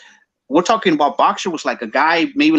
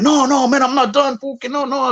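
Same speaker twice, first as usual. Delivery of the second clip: much higher, pleading no no no; insisting he's not done; 11 semitones higher than usual. Retold or imitated. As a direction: protest hard while being dragged off. Drunk brother refusing to stop.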